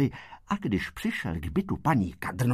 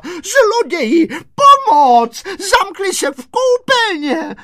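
very high, loud, drawn-out cries; screaming for help; 10 dB louder, slower than usual. A narrator's reading. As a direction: raise the alarm to the neighbours. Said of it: "vyběhla na chodbu a křičela". (křičí o pomoc, vysoko a táhle)

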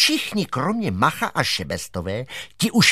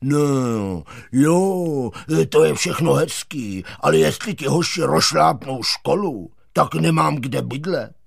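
reluctant school caretaker. Acted. Caretaker worries about losing his home, grudging. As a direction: grumble a hesitant objection.

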